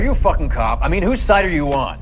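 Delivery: angry retort. Acted. Soldier snapping at his interrogator, angry and loud.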